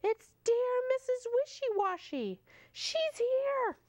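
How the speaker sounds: high joyful cheer; cheering animals. Acted. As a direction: shout with relief, she's come back. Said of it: "they cheer".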